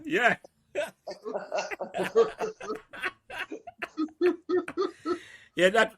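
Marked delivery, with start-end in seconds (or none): 0.7-5.2 big laugh. Real, not acted. Friends laughing at story.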